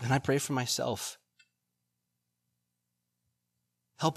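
earnest, measured prayer voice. Acted. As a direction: lead the congregation in prayer. Closing prayer.

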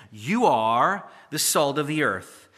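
"You" stressed, read slowly and weightily; slow, weighty reading that puts the stress on "You"; emphatic you. Shown as a demonstration. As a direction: lean on "You", slow and deliberate. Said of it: "It's emphatic in the Greek".

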